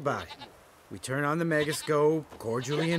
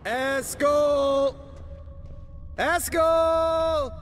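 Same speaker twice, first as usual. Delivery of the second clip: loud call, higher pitch; 9 semitones higher, 3 dB louder, much slower than usual. Acted. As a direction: shout his name across the keep. Calling for missing friend.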